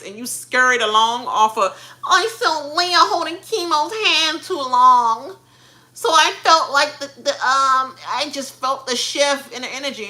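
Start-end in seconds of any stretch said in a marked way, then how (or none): 2.1-10.1 mocking Joseph. Mocking Joseph's diary room excuse, whiny. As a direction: imitate him as a put-on sulk. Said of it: "you always do this fake cry".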